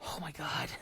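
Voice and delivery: unvoiced horrified whisper; mortified whisper to herself. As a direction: breathe it under your breath in shock. Whispered aside in tense scene.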